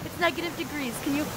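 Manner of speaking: high, shivery from the cold; freezing cold. Real, not acted. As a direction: fight the cold while talking. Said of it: "it's so cold".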